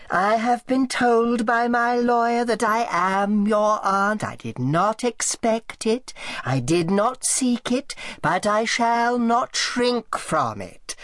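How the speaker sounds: whining, creaking aunt. Acted. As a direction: creak it out like a frail old tyrant. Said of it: "Her voice whined and creaked".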